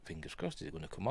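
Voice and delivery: whispered hopeful aside. Real, not acted. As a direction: breathe it under your breath. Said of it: "fingers crossed (repeated)".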